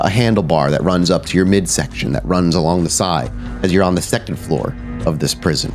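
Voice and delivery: usual speaking voice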